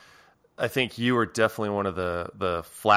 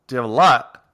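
imitating Australian; 4 semitones higher, 10 dB louder, faster than usual. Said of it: "was Australian".

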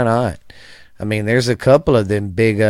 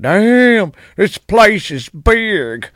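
high, slow awestruck gasp; drawn-out small-town wonder; amazed; 10 semitones higher, much slower than usual. Retold or imitated. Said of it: "You was like".

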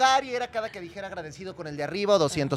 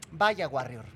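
a light take on Adi's line, lower; catchphrase said lower; imitating Adi's catchphrase; 6 semitones lower, much slower than usual. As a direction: lightly mimic her signature phrase. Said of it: "Adi cada que decía".

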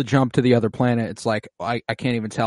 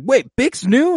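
surprised; 13 semitones higher, 6 dB louder, slower than usual. Retold or imitated. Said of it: "He's like".

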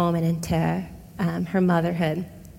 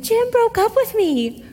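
much higher, slow and beaming; all smiles; 13 semitones higher, 3 dB louder than usual. Retold or imitated. Telling her mom about the breakup, all smiles and much higher. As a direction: say the breakup news with a big grin. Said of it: "And, like, all smiles".

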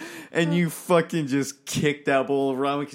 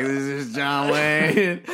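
put-on prank-call voice, words dragged out; prank caller; 3 semitones higher, 4 dB louder, much slower than usual. Retelling the prank call back and forth, slow put-on caller voice. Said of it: "He's like".

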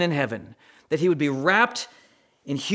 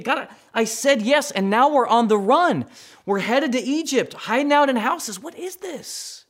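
higher, pleading as the parents on the run; parents' frantic thoughts; 9 semitones higher, 3 dB louder than usual. Retold or imitated. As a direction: voice the worried couple, rising to a hushed question at the end. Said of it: "They gotta be thinking".

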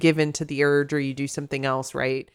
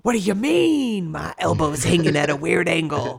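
hammed-up indignant cover-up; defensive wolf; 4 dB louder than usual. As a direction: act the injured loner brushing off concern. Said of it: "Whereas I'm like".

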